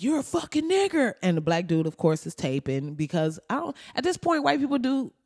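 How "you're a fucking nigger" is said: high-pitched mocking impression of a heckler. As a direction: jeer it in a raised pitch.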